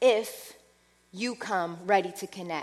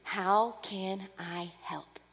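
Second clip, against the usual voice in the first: hushed, slow, soft voice of the Spirit; Holy Spirit offering help, hushed and slow; soft whisper; 3 semitones lower, 6 dB quieter, much slower than usual. Pretend. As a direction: breathe it gently like a whisper. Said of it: "constantly whispering to you".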